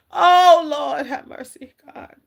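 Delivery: usual speaking voice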